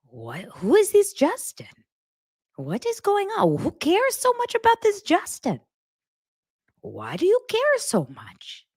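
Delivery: high-pitched, incredulous mom impression; worried mom. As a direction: question it like a baffled, fretting mother. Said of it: "She goes".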